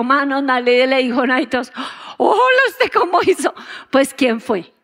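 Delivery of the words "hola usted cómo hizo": high-pitched astonished question; amazed onlookers. Acted. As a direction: ask in amazement, pitch jumping up. Onlookers amazed at his receiving the Spirit. (asombrados, con voz aguda)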